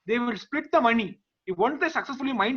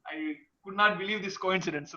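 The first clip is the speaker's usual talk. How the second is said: usual speaking voice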